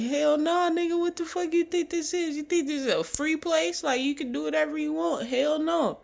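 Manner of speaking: hammed-up imitation of a harsh staff member yelling; mock angry staff yell. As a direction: tear into the kid like a mean guard.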